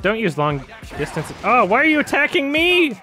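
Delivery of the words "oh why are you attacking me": high, loud startled cry; surprised outcry. Real, not acted. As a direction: yelp it out in alarm. Attacked suddenly in game.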